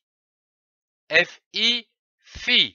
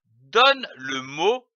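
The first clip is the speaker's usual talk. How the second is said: ordinary talk, nothing marked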